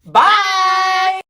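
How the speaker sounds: high, loud sing-song bye with laughter; cheerful yelled sign-off. Real, not acted. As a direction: shout the goodbye then laugh. Shouted outro goodbye.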